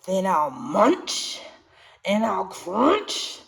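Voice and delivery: slow, menacing growl of a shark; big bad shark roaring at the little fish. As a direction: drag out each threat like a hungry shark. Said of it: "roared the shark".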